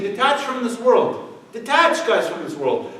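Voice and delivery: high, loud, slow mock sermonizing; mock preachy hypocrite. Mocking the hypocrite telling people to detach, high and loud. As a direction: ham up a pompous preacher urging detachment.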